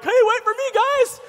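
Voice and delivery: high, loud mock goofy friend; hapless tagalong friend. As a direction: call after the group in a silly falsetto. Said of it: "there's always this one friend".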